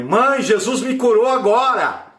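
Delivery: high, loud jubilant announcement; joyful shout. Retold or imitated. Healed son telling mother, high joyful shout. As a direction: proclaim the healing triumphantly.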